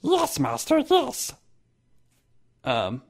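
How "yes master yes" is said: high, servile creeping voice; hunchback assistant. Acted. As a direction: grovel high like a servant. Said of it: "please be sure to warn us".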